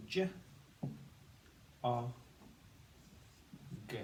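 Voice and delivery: J, O, G as separate phonics sounds, not letter names; sounded out. Shown as a demonstration. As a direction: say J, O, G as isolated sounds with long gaps. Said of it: "we say".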